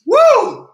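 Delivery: short, piercing "woo"; high loud whoop. Real, not acted. Host hyping viewers before the group scream, high whoop.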